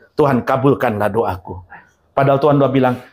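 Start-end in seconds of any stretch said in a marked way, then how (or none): 0.1-1.6 much lower, softer mock prayer; a lazy pious plea. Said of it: "believer praying". (doa pura-pura dengan suara rendah)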